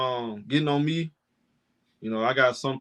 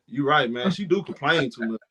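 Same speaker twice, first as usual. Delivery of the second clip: ordinary talk, nothing marked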